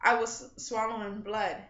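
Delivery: ordinary delivery, nothing marked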